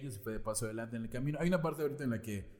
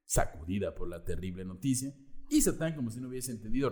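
usual speaking voice

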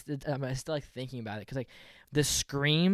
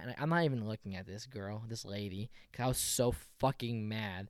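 usual speaking voice

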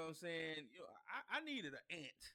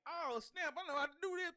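high, loud burst of eager confidence; excited; 11 semitones higher, 6 dB louder than usual. Acted. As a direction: leap into action, pitched way up. Acts out thriving in a storm.

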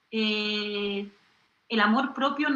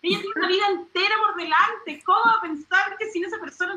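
exasperated protest; 7 semitones higher than usual. Retold or imitated. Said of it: "hablo con la tele".

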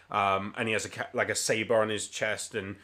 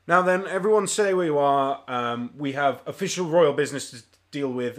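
loud, higher opening order, easing off; commanding officer; 3 semitones higher, 6 dB louder than usual. Acted. Royal officer announcing himself to the tavern, commanding voice. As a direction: address the whole room with official authority. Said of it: "officer kind of looks around and goes".

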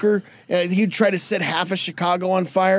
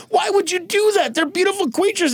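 high-pitched, hammed-up weepy protest; mock tearful; 12 semitones higher than usual. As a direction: wail it like a wounded softie. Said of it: "starts getting tears in his eyes".

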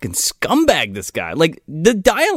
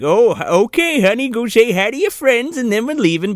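higher, sugary fake-sweet father voice; fake-nice dad; 7 semitones higher than usual. Dad putting on niceness before the friends: higher, sugary fake-sweet voice. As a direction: play the phony nice dad for show. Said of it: "the dad plays it up like".

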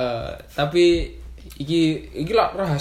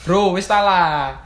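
higher, louder, exasperated insistence; 6 semitones higher, 4 dB louder than usual. Real, not acted. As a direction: wave it off, fed up.

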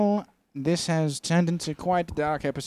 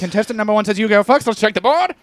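game show host; 6 semitones higher, 6 dB louder than usual. Pretend. Host announces result.

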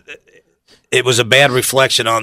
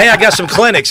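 higher and louder, cheerful announcement; excited call out; 10 semitones higher, 6 dB louder than usual. Retold or imitated. Telling coworkers about the clinics, a loud cheerful call. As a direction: sing out the news to the office. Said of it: "I said".

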